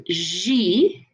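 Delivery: "J" said "zhee"; French letter J. Shown as a demonstration. Teacher explaining French J, said "zhee". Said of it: "gonna sound like a G".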